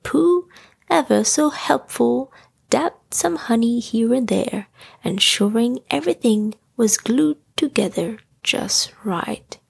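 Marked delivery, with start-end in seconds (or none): none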